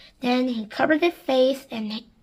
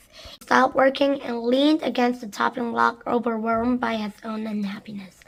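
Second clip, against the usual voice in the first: ordinary delivery, nothing marked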